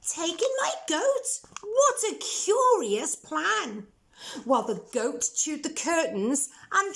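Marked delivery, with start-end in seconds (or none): 0.0-3.9 put-on high, questioning old lady voice; old lady baffled by the advice; puzzled old lady. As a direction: query the odd plan, high.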